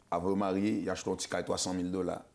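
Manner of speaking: usual speaking voice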